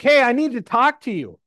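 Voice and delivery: called out high and loud to get attention; young man calling out to a passerby, high and loud. Retold or imitated. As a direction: hail a stranger on the street, loud and high. Young man calls out to speaker.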